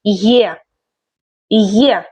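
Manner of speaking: gliding "yeh" for the letter. Shown as a demonstration. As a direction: say the letter as the start of yes. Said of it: "as in yes".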